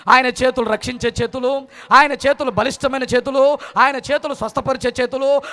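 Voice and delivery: loud, driving rhythmic litany; full-force preaching. Acted. Preacher recapping God's hands to the congregation, full-force preaching. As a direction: hammer out each phrase with rising fervour.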